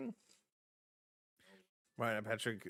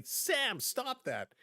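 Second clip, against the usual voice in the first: high-pitched mock telling-off; imitated scolding; 8 semitones higher, much slower than usual. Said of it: "give me a whack and say".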